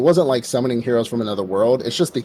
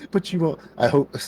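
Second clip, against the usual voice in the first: put-on evil girl; 6 semitones higher than usual. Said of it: "that evil, like, girl laugh".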